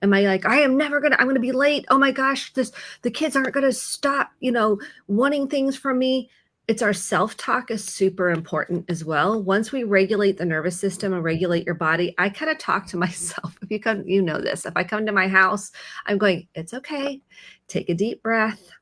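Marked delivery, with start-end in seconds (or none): 0.5-6.3 high-pitched, fretting inner voice; acted-out flustered parent running late; worked-up self-talk, pitched well up; panicked self-talk. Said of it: "how am I talking to myself". 16.5-18.7 calming self-talk. Acted. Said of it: "to calm myself down".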